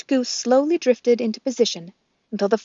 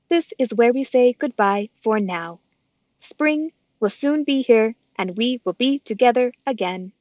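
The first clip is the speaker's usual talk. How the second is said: robot voice; 3 semitones higher, 5 dB louder than usual. Acted. Roz the robot speaks.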